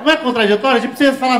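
ordinary talk

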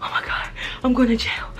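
high, breathy mock-panicked whisper; scared whisper. Patient fearing police will lock them up, frightened whisper. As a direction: whisper it in hushed dread. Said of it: "getting even more scared".

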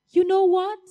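usual speaking voice

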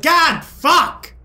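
slow, drawn-out cry of frustration; swearing at the game after messing up; frustrated outburst. Real, not acted. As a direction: let the curse burst out slowly. Frustrated outburst during play.

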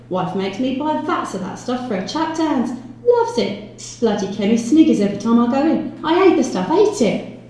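grumbling detective. Acted. Detective complaining about his wife's errands, high exasperated grumble. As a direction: build to loud, high loathing. Jones ranting about buying Vaseline.

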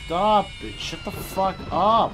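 high, loud shout to make it stop; loud, slowed-down angry yell; furious shout. Real, not acted. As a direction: yell at the screaming thing to stop. Yelling at screaming game.

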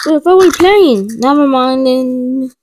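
loud playground call. Pretend. Kid shouting to friends about the game, loud. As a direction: yell it across the playground.

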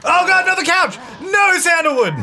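very high, loud scolding cry; panicked high-pitched yell; dismayed shout. Real, not acted. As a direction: shriek at the cat to stop. Cat scratching another couch.

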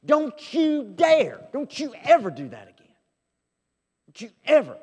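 sudden loud, high furious rebuke of the disciples; indignant rebuke. Acted. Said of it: "he was indignant".

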